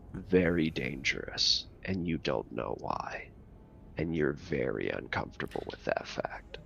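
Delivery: ominous hush. Acted. Game master warning the players of danger, hushed and ominous.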